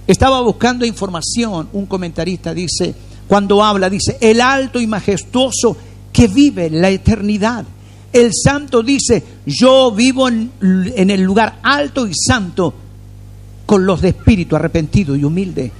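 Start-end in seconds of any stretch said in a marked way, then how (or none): 4.2-15.7 scripture reading. Acted. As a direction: read it as holy words from on high.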